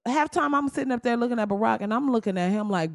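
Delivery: ordinary talk, nothing marked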